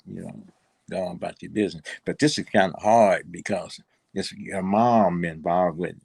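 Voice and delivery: ordinary talk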